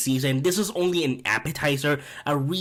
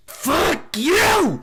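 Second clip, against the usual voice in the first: much higher, louder furious yell; reliving the outrage; 13 semitones higher, 5 dB louder than usual. Retold or imitated. Yelling at Oz on screen during the finale, furious. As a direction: scream it at the screen. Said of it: "and I was like".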